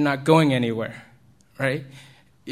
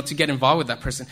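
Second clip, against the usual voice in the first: ordinary talk, nothing marked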